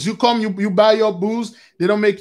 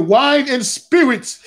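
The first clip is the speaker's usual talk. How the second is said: chuckling through the words; amused, half-laughing delivery; 4 semitones higher, slower than usual. Real, not acted. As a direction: laugh as the pun lands. Joke on liquor store as spirits.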